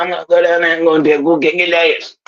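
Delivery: rapid, slurred country rant, mouth half full of words; fast garbled drawl. Retold or imitated. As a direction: rattle it off like a broken chainsaw. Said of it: "He sounded like a fucking broken chainsaw".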